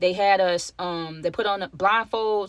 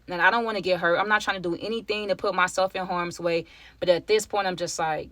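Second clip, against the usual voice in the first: normal talking voice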